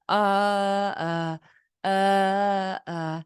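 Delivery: a flat "uh" in place of the A sound; short neutral "uh" vowels; centralized vowel. Shown as a demonstration. Demonstrating the centralized vowel a child uses. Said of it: "So it's centralized".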